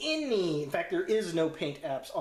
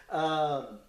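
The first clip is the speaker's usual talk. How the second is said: ordinary talk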